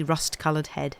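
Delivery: ordinary delivery, nothing marked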